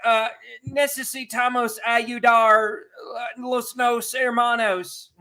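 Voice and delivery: put-on high, slow halting American-accented Spanish; mock politician's stumbling Spanish; Cory Booker. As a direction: labor through the Spanish, loud and drawn out. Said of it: "about to speak in Spanish".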